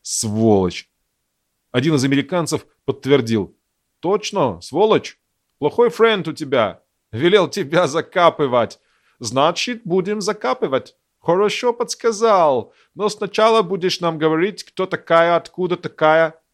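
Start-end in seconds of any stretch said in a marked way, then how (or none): 0.0-0.9 low hiss through gritted teeth; whispered through gritted teeth. Acted. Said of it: "скрипя зубами, прошептала". 4.0-16.4 mocking in an American accent. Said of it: "американским акцентом".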